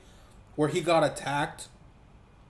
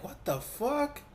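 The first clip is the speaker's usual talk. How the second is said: higher, softer, slow incredulous question; stunned disbelief; 8 semitones higher, 5 dB quieter, slower than usual. Retold or imitated. Reacting to the film's box office, slow stunned disbelief. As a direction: let the disbelief hang. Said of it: "And I was like".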